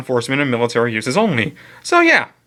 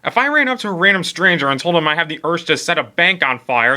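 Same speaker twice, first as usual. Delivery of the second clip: ordinary delivery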